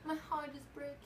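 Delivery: mock despair, soft and pleading; mock heartbreak. Fan mourning a dead favourite with soft mock heartbreak. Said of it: "It's fan voice".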